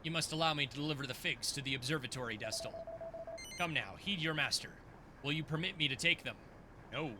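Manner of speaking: low, solemn teacher voice; stern old mentor. Acted. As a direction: speak as a grave celestial master.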